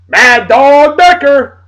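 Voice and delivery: loud, high, drawn-out name call; hyped shout-out. Real, not acted. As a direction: belt out the name like a hype man. Hyped shout-out to an admired man.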